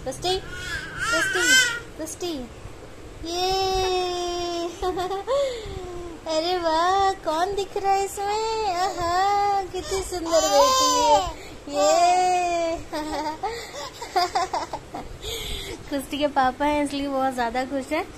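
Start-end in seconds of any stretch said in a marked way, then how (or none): 0.0-3.5 calling out. Real, not acted. Calling the baby's name. 3.6-6.2 delighted laugh. Real, not acted. 6.3-12.8 high, loud and thrilled; delighted. Real, not acted. Delighted at baby on screen. 12.8-15.8 joyful laugh. Real, not acted. Laughing with joy.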